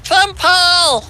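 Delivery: put-on high squealing anime girl voice; overacted anime dub. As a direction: beg senpai in a high fangirl squeal. Voicing Kawaii Duck to senpai.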